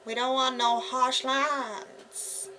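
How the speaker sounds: hyper, hammed-up drawl; hyper and silly. Real, not acted. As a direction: stretch it out slowly, giddy and over the top. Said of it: "Sorry, I'm on, uh, hyper today".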